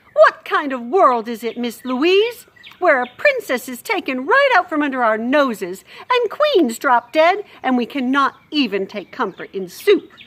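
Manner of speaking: distraught cook. Acted. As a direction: cry out at the unfairness. Cook's tearful lament.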